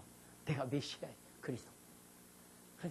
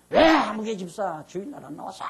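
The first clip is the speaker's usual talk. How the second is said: louder mock rebuke of a deacon; scolding pastor; 4 semitones higher, 11 dB louder than usual. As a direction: scold the absent deacon from the pulpit. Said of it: "질책도 하고". (집사를 꾸짖는 목사의 호통)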